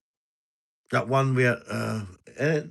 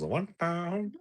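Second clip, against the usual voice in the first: much higher, softer put-on voice; squeaky trader price call; 10 semitones higher, 6 dB quieter than usual. Streamer finishing a mock market call.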